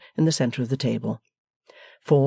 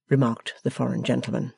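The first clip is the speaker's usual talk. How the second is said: ordinary delivery, nothing marked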